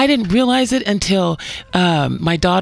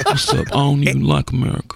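low, fast mock swagger; impression of Brittany Griner; 8 semitones lower, faster than usual. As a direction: toss it off in a deep lazy voice. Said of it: "do an impersonation".

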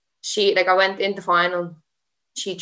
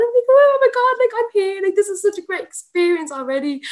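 much higher and louder, bubbly squeal; thrilled quote; 14 semitones higher, 4 dB louder than usual. Retold or imitated. Judoka telling the interviewer about arriving at the tournament, high thrilled gush. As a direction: gush like you can't believe you're here.

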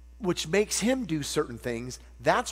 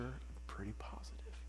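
whispered, much softer; still small voice; 4 semitones lower, 18 dB quieter than usual. Retold or imitated. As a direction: breathe it as a gentle inner whisper. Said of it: "the still small voice".

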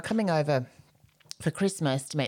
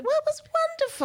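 much higher, swooping gush; mock gushing. Shown as a demonstration. Co-host mocking the word, a high falsetto gush. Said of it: "That's an awkward word".

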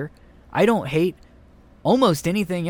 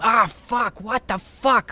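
pretend scream; 8 semitones higher than usual. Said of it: "she can't just scream".

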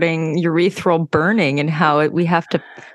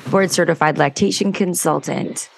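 normal talking voice

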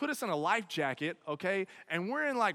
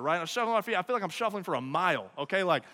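usual speaking voice

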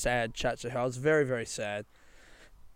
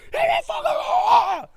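shrill-pitched, loud furious yell; angry scream; 6 dB louder than usual. Acted. Robbed man screaming something angry at the thief, angry scream. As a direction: scream it angrily at the top of the voice. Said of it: "scream something angry".

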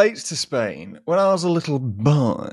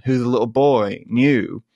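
ordinary talk, nothing marked

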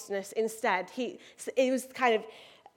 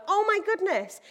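higher, exclaimed mock dismay; acted dismay; 7 semitones higher than usual. Believer reacting first when things go wrong, higher mock dismay. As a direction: cry out as a first, unguarded reaction. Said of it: "our initial response is sometimes one of".

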